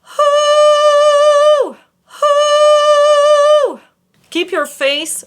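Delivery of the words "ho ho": full loud head voice; fuller high ho. Shown as a demonstration. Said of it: "Make the sound fuller".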